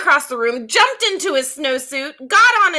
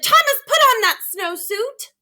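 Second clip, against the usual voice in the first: much higher, pleading-angry order; a mother losing her patience, pitched way up; exasperated mother; 10 semitones higher, 3 dB louder than usual. Acted. Mother yelling at stubborn son, high and exasperated. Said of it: "jumped up and down and said".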